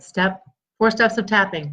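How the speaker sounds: ordinary talk, nothing marked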